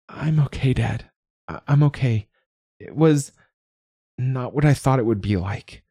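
high, halting daughter voice; strained, trying to reassure her father; pained, strange voice. A narrator's reading. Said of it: "Her voice still sounded strange and pained".

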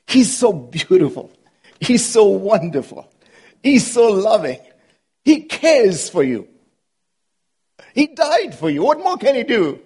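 adoring praise. Real, not acted. Slow adoring preaching.

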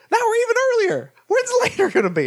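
very high, squeaky disbelief; Charlie Day. Retold or imitated. Actor finding himself in medieval times, high exasperated imitation. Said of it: "my impression of Charlie Day".

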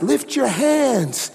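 high, stretched-out command; preacher calling out. Retold or imitated. Preacher tells him to raise hands, calling out high. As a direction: imitate a preacher rallying the room.

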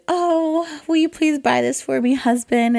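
high-pitched mock-posh lady begging her husband; pleading wife. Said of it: "lends itself to a lot of acting".

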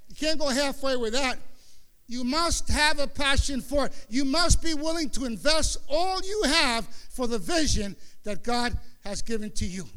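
loud, driving exhortation; preaching at full force. Real, not acted. Preacher urging the church to give everything, loud. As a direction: push it out like a pulpit climax.

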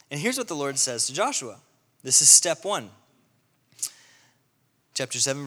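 ordinary talk

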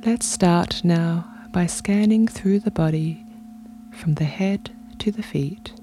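unhurried meditation voice with long pauses; guided meditation. Real, not acted. Podcast host guiding listeners into their bodies, calm meditation delivery. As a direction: guide them gently through breath and body, leaving space between phrases.

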